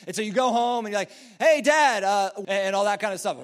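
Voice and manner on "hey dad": high, loud call to Dad; excited shout for attention. Acted. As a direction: call out eagerly to a parent. Acts out calling to dad.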